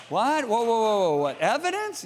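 mock-startled, pitch jumping up on the question; hammed-up disbelief; startled objection. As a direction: act out a listener balking.

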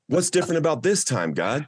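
half-replaying the anguished cry upward; retold yell at God. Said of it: "I'm screaming at him".

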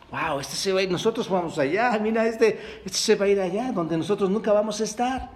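lightly mocking onlookers. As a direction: scoff at him with a touch of mockery. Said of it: "Era una burla, hermanos".

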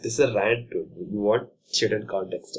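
usual speaking voice

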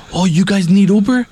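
mock eager airport hustler; pushy driver. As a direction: sell the ride like a street tout.

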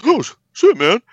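high, loud imitated oh shit man; owner's mock alarm. Owner walking up to the employee after the crowd cheers, hammed-up high exclamation.

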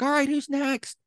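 imitating the rowdy woman rounding up the next shot. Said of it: "and she's like".

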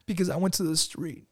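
put-on a light kid voice, answering dad; imitating his young son. Son's voice in retold dialogue.

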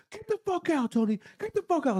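high-pitched mock angry order; imitated pissed-off. Andy kicking Tony out of the car, mock pissed-off. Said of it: "he was fucking pissed".

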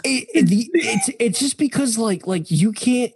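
very high put-on cartoon voice; squeaky mock cartoon complainer; SpongeBob-like voice. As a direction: stammer excuses in a high cartoon whine. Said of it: "It's very SpongeBob-esque".

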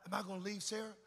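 hushed, fast, frightened inner voice; panicked whispered thoughts. Retold or imitated. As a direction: rush it under your breath like a scared thought.

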